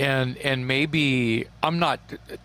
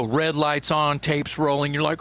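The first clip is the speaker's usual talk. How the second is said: normal talking voice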